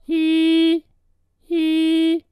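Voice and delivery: high note in a compressed head voice; head voice demo. Shown as a demonstration. Vocal coach demonstrating his unsteady head voice. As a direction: sing it high with a bit of chest ring. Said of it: "it sounds like this with my voice".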